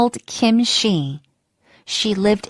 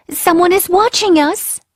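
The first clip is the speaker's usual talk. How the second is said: higher, louder frightened cry; alarmed; 8 semitones higher, 4 dB louder than usual. Acted. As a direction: warn him in a startled rush. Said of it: "she gasped".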